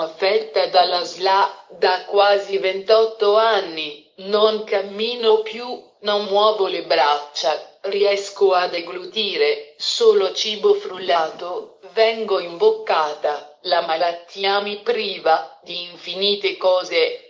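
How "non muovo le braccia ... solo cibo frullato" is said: ordinary talk, nothing marked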